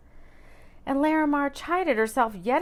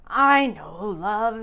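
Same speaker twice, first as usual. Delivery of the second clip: disappointed; 7 dB louder, much slower than usual. A narrator's reading.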